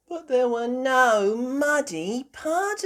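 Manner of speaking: high, loud piglet after a yawn; high-pitched yawny character voice; yawning Peppa. A narrator's reading. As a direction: speak up high as a sleepy cartoon pig. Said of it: "Peppa yawned".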